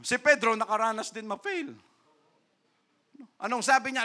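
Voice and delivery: ordinary delivery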